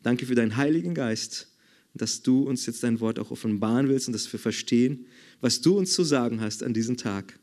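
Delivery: soft, low prayer voice; hushed prayer before the sermon. Acted. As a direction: pray it gently and inwardly.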